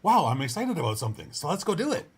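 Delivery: loud, high burst of excitement; rally yourself with put-on enthusiasm; excited. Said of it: "gives that dopamine rush that says".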